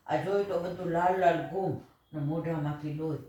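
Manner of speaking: ordinary delivery, nothing marked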